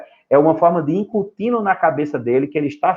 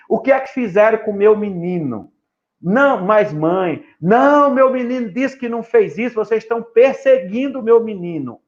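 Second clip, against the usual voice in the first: higher, a touch of hammed-up outrage; indignant mother; 6 semitones higher than usual. Mother at school defends her son, mock indignant. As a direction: play the offended rich mom.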